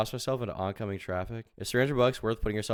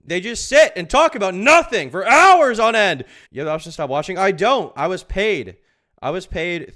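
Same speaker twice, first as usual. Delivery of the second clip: loud, much higher, yelling in disbelief; exasperated rant; 8 semitones higher, 15 dB louder than usual. Real, not acted. Streamer yelling to chat that he can't stop watching, loud rant. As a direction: vent like you're trapped. Ranting about being paid to watch.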